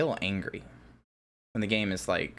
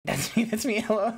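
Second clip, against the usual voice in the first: ordinary talk, nothing marked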